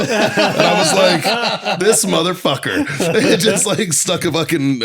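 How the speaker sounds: high, slow laughter through the words; cracking up at the memory; laughing. Real, not acted. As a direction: laugh as you tell it. Laughing retelling the medal moment.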